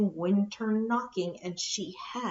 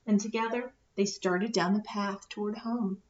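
ordinary delivery

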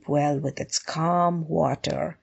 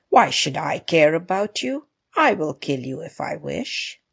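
ordinary delivery

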